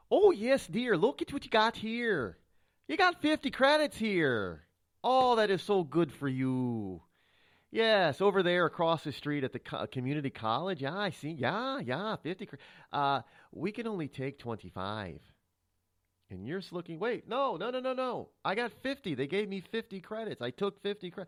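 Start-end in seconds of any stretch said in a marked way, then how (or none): 0.1-15.2 thick Minnesota lilt with stretched vowels; Minnesota accent. Acted. College official to a student with fifty credits, Minnesota accent. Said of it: "I'm gonna use my Minnesota voice". 17.0-21.2 high-pitched mock protest; protesting student. Student to college official over lost credits, protesting.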